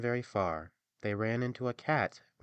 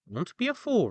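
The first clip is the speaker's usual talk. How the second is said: much higher, louder chiding donkey; 13 semitones higher, 8 dB louder than usual. Acted. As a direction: scold kindly as the donkey. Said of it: "said the donkey".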